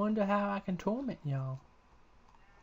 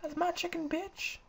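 much higher, squealing repeated outrage; high outraged yell; slower than usual. Real, not acted. Player screams over his killed chicken, high squeal. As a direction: squeal it, furious about the chicken.

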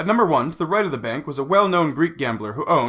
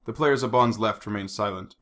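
ordinary delivery, nothing marked

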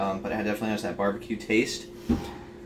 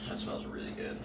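whispered; 13 dB quieter than usual. Real, not acted. Sniffing the food, savouring.

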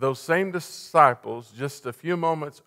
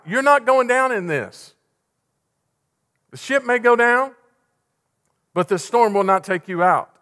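loud, raised, driving sermon voice; impassioned preaching; 5 semitones higher, 7 dB louder than usual. Real, not acted. Preacher urging the congregation on, fervent and loud. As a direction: build to a forceful, high-pitched declaration.

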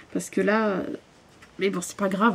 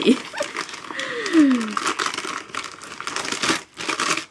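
high, breathy giggle at her own mistake; 8 semitones higher than usual. Real, not acted. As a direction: laugh it off, embarrassed. Rit de sa prononciation. (petit rire gêné)

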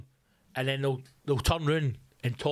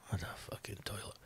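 hushed, fast muttered jab; whispered aside; 7 semitones lower, 15 dB quieter, faster than usual. Real, not acted. Comic telling the audience about a TV show, in a whispered aside. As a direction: drop to a sneaky whisper for the insult.

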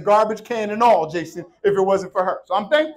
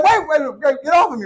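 higher, louder mock panic, wait wait; panicked bird; 6 semitones higher, 4 dB louder than usual. Pastor voicing the bird as the cat bites, mock panic. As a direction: plead with the cat in hammed-up alarm. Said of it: "And he's like".

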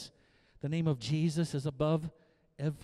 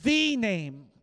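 "the" said as a stressed "thee". Shown as a demonstration. Preacher showing the Greek emphasis, "the" stressed as "thee". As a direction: lean on "the" as "thee", then "name". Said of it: "In the Greek, it emphasizes the name".

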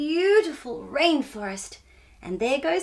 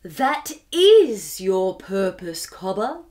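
lower, slow and solemn; wisest owl voice; 5 semitones lower, 5 dB louder, slower than usual. Acted. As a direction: deliver it like an ancient wise owl. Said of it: "said the owl in her wisest voice".

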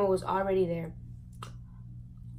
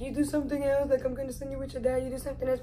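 higher, a bit softer mock mom warning; imitating mom; 4 semitones higher, 3 dB quieter, much faster than usual. Retelling mom's threat to send her to dad, mock stern. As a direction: do mom's scolding voice lightly. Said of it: "my mom will always say".

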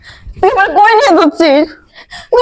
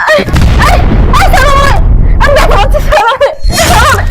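pained shout; 5 semitones higher than usual. Acted. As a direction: yell to be let go, hurting. Madwoman grabbed, crying out in pain. (ব্যথায় জোরে চিৎকার)

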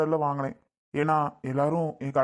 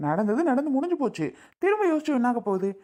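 higher, pleading with his sulking wife; husband begging his wife to let it go, pleading higher; 7 semitones higher than usual. Acted. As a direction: coax her, voice rising high.

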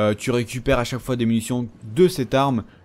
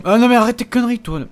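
high, loud cry of exasperation; exasperated shout; 9 semitones higher, 6 dB louder, faster than usual. Real, not acted. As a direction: yell it at the enemy. Crie sur un ennemi. (crie, exaspéré, bien plus haut et plus fort)

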